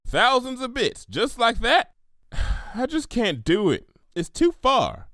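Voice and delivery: lightly voiced country mare, conflicted; torn farm pony. Applejack explaining her limits to Pinky, mildly pained. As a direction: hold the line with a sigh.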